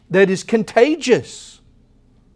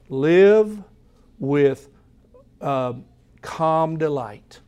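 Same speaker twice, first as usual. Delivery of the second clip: calm delight; 3 semitones lower, much slower than usual. Retold or imitated. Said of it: "He's saying".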